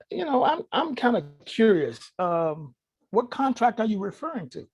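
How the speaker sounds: playing dumb. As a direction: fake curiosity to set a trap.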